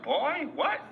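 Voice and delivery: put-on high, startled girl's voice; mock shocked girl. Girl answering his blunt question, high mock shock. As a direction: play her as taken aback. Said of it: "she was like".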